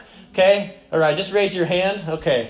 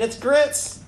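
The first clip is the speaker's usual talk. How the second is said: higher-pitched, sarcastic Southern waitress; 7 semitones higher than usual. Acted. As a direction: answer as if it were obvious, pitched up with mock sarcasm. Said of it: "replied with an air of sarcasm in her voice".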